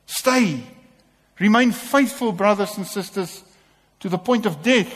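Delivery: impassioned call from the pulpit; preaching at full force. Acted. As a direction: lift them up with a ringing appeal. Preaching climax, loud and high.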